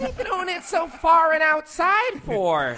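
high-pitched complaining mom; high, questioning mom voice; mom impression. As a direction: whine it like a mother watching the game. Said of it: "something my mom would say".

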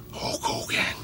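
breathless whisper of the name. Acted. Announcer whispering the wrestler's name before the roar. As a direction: hiss the name under your breath. Drill sergeant barking at pilot.

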